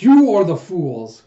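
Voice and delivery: loud, slow retold accusation; indignant rebuke. Woman turns on the jeering crowd, indignant rebuke. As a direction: hit the first word hard and draw it out.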